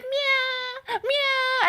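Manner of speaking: very high squeaky cat meows; mock meowing. Friend mocking the anime heroine's cat act, squeaky high meows. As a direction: mimic a cutesy girl meowing like a cat.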